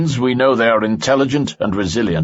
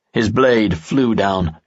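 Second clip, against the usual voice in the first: ordinary delivery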